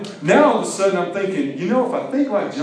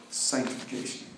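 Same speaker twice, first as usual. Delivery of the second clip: Sanctification whispered, much softer; 3 semitones lower, 12 dB quieter than usual. Shown as a demonstration. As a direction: breathe Sanctification as a hushed word. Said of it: "Let that sink in a minute".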